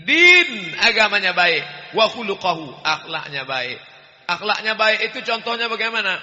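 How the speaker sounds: preacher pressing the congregation; emphatic preaching. Real, not acted. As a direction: drive the point home. Preaching at full force.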